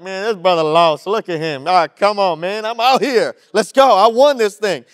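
loud and pumped, bragging at the loser; cocky swimmer. Retold or imitated. Second swimmer mocks the tired first one, loud and cocky. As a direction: shout it like you already won. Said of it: "he's like".

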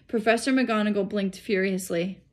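usual speaking voice

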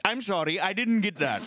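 put-on high-pitched Indian-accented automated prompt; robot phone agent. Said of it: "and it goes".

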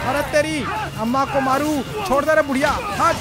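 loud, high angry yell at granny; angry shout. Real, not acted. Granny grabs him, he yells. (गुस्से में ज़ोर से चिल्लाना)

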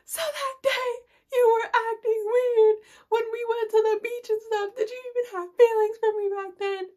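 high, loud sobby wail; mock crying. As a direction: ham up a tearful accusing question. Acts out her tearful question.

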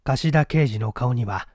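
ordinary delivery, nothing marked

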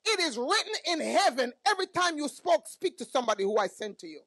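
preacher's shouted climax; shouted proclamation. Real, not acted. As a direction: proclaim it at full force like a prophet. Shouted prophetic declaration.

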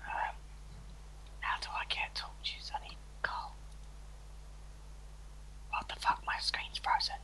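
secret whisper to viewers. Real, not acted. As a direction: whisper it so no one hears. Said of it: "Don't tell Aaron".

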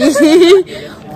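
a loud burst of laughter; loud laugh at being interrupted; sudden laugh. Real, not acted. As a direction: crack up mid-sentence.

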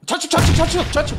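high, loud urgent calling; urgent callout. Real, not acted. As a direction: bark the direction out fast.

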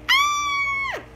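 puppy's high frightened yelp; terrified scream. A narrator's reading. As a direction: shriek and run away. Terrified puppy flees the kittens.

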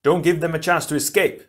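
high, loud urgent order; shouted. A narrator's reading. Tahu orders the Toa to block escape, shouted. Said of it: "Tahu shouted".

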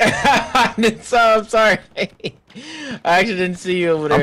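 laughing apology. Real, not acted. Apologizing after teamkill.

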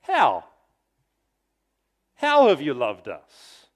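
loud, indignant challenge of How; indignant demand. Retold or imitated. Israel hurling an accusing question at God, loud and indignant. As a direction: hurl the question back in protest. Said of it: "They say".

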